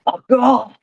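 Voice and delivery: put-on Irish accent, high and sudden. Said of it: "in my Irish accent, I'm not gonna shout it".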